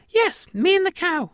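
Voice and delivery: very high, loud squeaky confirmation; high-pitched mouse, falling at the end; tiny alien mouse. Acted. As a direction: squeak it back firmly like a mouse. Mouse echoes the farmer.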